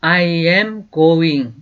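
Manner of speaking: slow, clear teacher's model, each word apart; slow demo pronunciation; slow model sentence. Shown as a demonstration. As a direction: say it slowly for students to repeat. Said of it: "어디로 가요".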